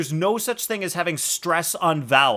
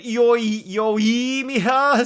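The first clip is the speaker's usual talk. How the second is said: Yoimiya with a stretched, higher yo-EE; stress on first E; 7 semitones higher, 3 dB louder, much slower than usual. Shown as a demonstration. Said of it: "put a stress on the first E".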